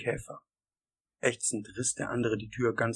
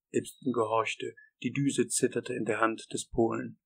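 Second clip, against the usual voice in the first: ordinary delivery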